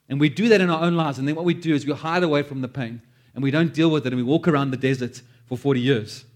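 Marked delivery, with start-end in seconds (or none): none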